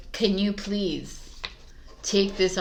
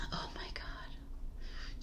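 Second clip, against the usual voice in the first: whisper; 18 dB quieter, much slower than usual. Real, not acted. Reading the card, then aside.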